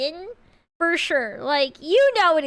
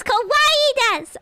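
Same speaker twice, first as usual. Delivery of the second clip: cute anime voice; 7 semitones higher, 4 dB louder, slower than usual. Pretend. Anime-style cute phrase.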